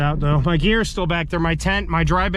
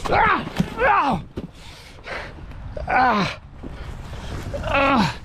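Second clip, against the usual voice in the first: startled cry; 6 semitones higher, 3 dB quieter, much slower than usual. Real, not acted. Fleeing in the dark, falling.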